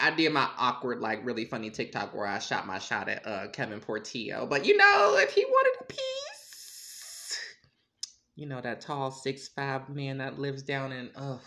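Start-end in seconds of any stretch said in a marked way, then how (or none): 4.6-7.4 squealed, way up high. Real, not acted. Joking about crush.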